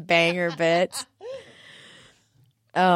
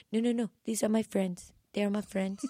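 put-on [name]'s accent; 2 semitones higher, 5 dB quieter than usual. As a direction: softly protest in an imitated singer's accent. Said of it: "that accent where she's like".

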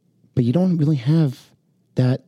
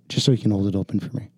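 ordinary talk

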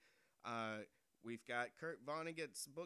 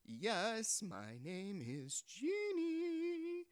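genie; 9 semitones higher, 5 dB louder, much slower than usual. Acted.

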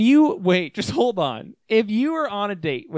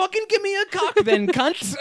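high, rushed mock Aussie through laughter; hammed-up crude Australian, cracking up; Australian accent; 13 semitones higher than usual. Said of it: "Mine was Australian".

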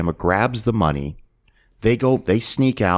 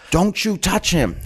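high, slow, drawn-out warning; mock protective warning; 11 semitones higher than usual. Cop protecting the informant he bonded with, mock fierce warning. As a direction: draw the warning out, acted and high.

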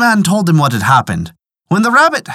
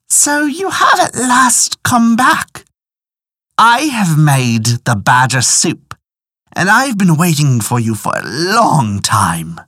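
lightly voiced old wife, slow and drawn out; old woman's greeting; 4 semitones higher, slower than usual. A narrator's reading. Disguised badger greets farmer as his old wife, slow welcome. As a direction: start high, then settle into a measured welcome. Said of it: "assumed the old woman's form".

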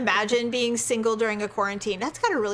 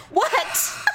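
exasperated, high-pitched demand; high, fed-up "what now" voice; seething snap; 9 semitones higher than usual. Retold or imitated. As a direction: re-enact an irritated outburst at an interruption. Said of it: "I'm only seething".

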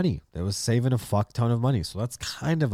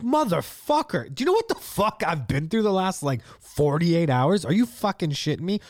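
retold rant, pitched way up; incredulous outburst; 8 semitones higher than usual. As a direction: replay the disbelief at the cop, high and strained. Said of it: "I was like".

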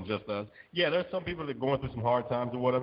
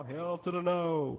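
sassy; 6 semitones higher, slower than usual. Pretend. Sassy refusal.

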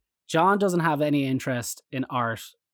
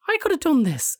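1 dismissive friend; 13 semitones higher than usual. Retold or imitated. John quoted dismissing Pollock.

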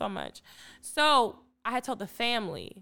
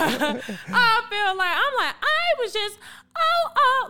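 half-laughing, half-crying, high and slow; a high laugh that breaks into a cry; laughing through tears; 11 semitones higher, 9 dB louder, slower than usual. Real, not acted. Overwhelmed retelling a job return.

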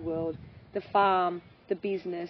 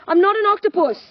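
high, loud, fed-up mum; exasperated cry; exasperated outburst; 10 semitones higher, 11 dB louder than usual. Retold or imitated. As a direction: blurt it out, at the end of her rope. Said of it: "a famous saying in my household is".

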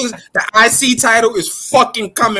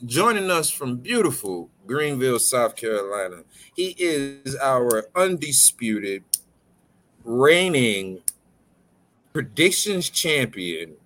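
announcer; 5 semitones lower, 6 dB quieter, slower than usual. Acted. Announcer introduces champion.

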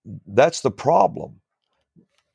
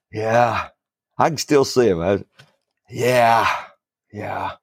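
put-on gruff old man through clenched teeth; talking through teeth; 2 semitones lower than usual. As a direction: speak through gritted teeth. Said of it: "and he talked through his teeth".

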